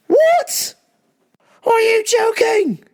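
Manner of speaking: high-pitched, incredulous squawk; hammed-up shock at being hung up on; outraged disbelief. Disbelief after mom hangs up.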